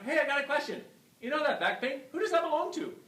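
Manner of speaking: high, eager, laughing a little; excited question. Retold or imitated. Chiropractor eagerly asks his patient about his back pain, high and bright.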